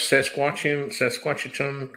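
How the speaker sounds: Saskatchewan stumbled over as "Sasquatchewan"; fumbled pronunciation. Real, not acted. Asking friends how to pronounce Saskatchewan, fumbled as Sasquatch. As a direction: try Saskatchewan, sliding into Sasquatch. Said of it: "How did, how did you pronounce".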